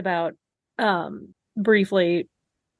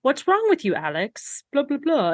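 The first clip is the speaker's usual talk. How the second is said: much higher, lightly put-on Doctor Davis's accent; 6 semitones higher than usual. Doctor Davis asking Alex what's wrong, in her accent. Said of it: "in her beautiful accent".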